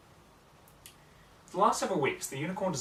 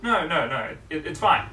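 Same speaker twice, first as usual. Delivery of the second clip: louder, flustered no no no; louder, hurried Twilight protest; hasty protest; 5 semitones higher, 11 dB louder than usual. Acted. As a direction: wave it off in a rush, louder. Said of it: "Twilight said quickly".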